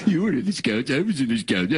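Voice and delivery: mimicking the host in a Scottish accent. Sidekick parrots the host's complaint, mock Scottish accent. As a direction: throw his words back in mock Scottish. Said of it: "Did you do a Scottish accent there?".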